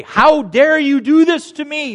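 high, loud, drawn-out shout; indignant challenge. Acted. Man picking an argument with God, indignant shouting. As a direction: confront God in full-voiced outrage. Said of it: "to pick an argument with you".

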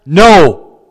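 put-on defiant child's refusal. Peter telling his master no, loud childlike defiance. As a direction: refuse point-blank like a child. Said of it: "had a child tell you no".

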